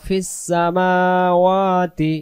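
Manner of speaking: ফিস সামাওয়াতি slow with the মা and ওয়া held long; long alif. Shown as a demonstration. Teacher demonstrating long vowels in সামাওয়াতি. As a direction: hold each alif in সামাওয়াতি. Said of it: "এক আলিফ".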